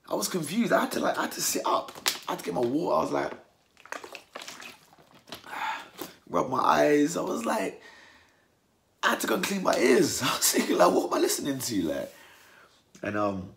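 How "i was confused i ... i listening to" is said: disbelieving. Real, not acted. Exaggerated disbelief at boxer's claim.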